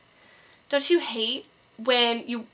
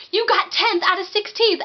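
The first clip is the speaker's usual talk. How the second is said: higher, louder excited read-out; much higher, louder text read with glee; thrilled reading; 9 semitones higher, 5 dB louder than usual. Retold or imitated. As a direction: announce the news in delight. Text message results read.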